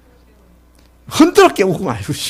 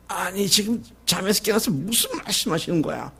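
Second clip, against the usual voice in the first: puzzled disciple; 4 semitones higher, 8 dB quieter than usual. Retold or imitated. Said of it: "그랬을지도 몰라요".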